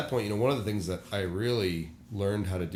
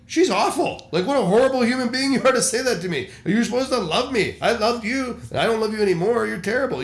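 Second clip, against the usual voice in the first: much higher and louder, fast hurt outburst; indignant rant; 11 semitones higher, 8 dB louder than usual. Retold or imitated. Pastor venting hurt at a critical babysitter, shrill indignant rant. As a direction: rant like a wounded pastor lashing out.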